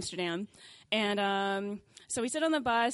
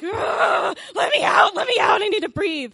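high, loud yell to be let out; panicked yell; 9 semitones higher, 13 dB louder than usual. Retold or imitated. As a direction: bang on the glass and shout to get out. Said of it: "start banging on the window like".

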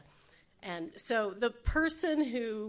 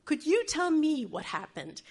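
pointed questioning; 7 semitones higher, 5 dB louder than usual. Retold or imitated. Another questioner in the hospital gauntlet.

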